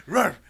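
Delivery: a sudden loud, high bark "Ruff"; dog bark. Retold or imitated. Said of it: "and she went".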